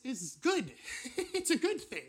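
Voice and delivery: high, laughing through the words. Real, not acted. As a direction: laugh it out with relief. Preacher laughs at the irony.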